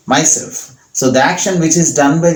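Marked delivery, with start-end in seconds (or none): none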